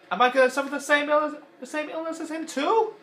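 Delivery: high, loud accusing question; Mister Potato Head voice. Retold or imitated. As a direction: hurl it like an angry toy. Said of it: "I mean, what if I keep refusing his death?".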